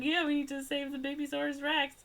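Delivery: high-pitched earnest agreement, sent up; put-on high voice for a retold character; mock-earnest heroine. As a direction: imitate a movie heroine, high and eager.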